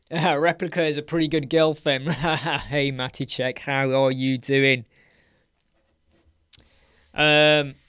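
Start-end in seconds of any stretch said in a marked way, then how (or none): none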